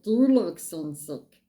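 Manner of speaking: usual speaking voice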